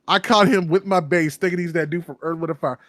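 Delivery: usual speaking voice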